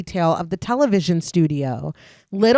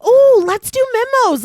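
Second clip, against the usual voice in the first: put-on high loud childish ooh; childish glee; 14 semitones higher, 7 dB louder than usual. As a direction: sound like an excited little boy. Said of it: "he's so, like a little boy".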